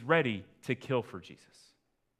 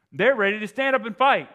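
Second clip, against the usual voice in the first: much louder and higher, full force; preaching shout; 10 semitones higher, 15 dB louder than usual. Real, not acted. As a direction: cry it out to the whole room. Preacher builds to climax.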